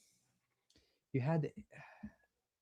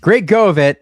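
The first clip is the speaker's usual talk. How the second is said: loud, rising hype; excited praise; 5 semitones higher, 11 dB louder, slower than usual. Real, not acted. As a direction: shout out the praise. Loud praise after the game.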